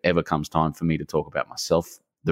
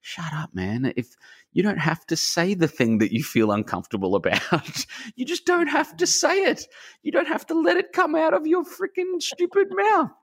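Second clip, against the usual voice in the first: high, exasperated self-scolding that climbs much higher; mock self-rebuke, pitched way up and laughing; scolding himself. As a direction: tell yourself off in a squeaky, fed-up mock voice. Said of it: "the biggest learning curve for me was just like".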